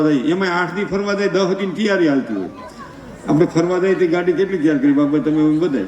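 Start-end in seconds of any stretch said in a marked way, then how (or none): none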